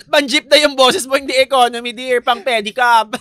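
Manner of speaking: high-energy loud jeering; loud teasing. Real, not acted. Co-host teasing host that her voice is jeepney class, loudly. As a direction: tease at full volume.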